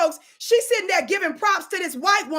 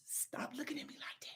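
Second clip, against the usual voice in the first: barely audible whisper; whispered mock warning; 3 semitones lower, 25 dB quieter than usual.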